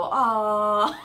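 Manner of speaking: laughing aww; imitated group aww. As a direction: coo like charmed onlookers. Coworkers see the photo.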